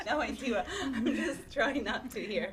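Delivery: loud, slowed words through giggles; trying to talk through real laughter; laughing. Real, not acted. Cracking up while trying to answer.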